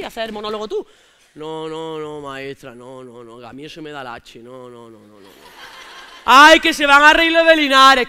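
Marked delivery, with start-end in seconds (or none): 6.2-8.1 lento y más fuerte, indignado de broma; suéltalo alto y despacio, protestando; exclamación fuerte. Acted. Espontáneo replica al cómico. (espontáneo de Jaén protesta a gritos lentos)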